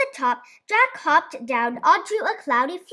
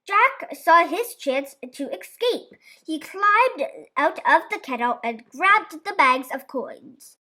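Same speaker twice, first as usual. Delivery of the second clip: ordinary delivery, nothing marked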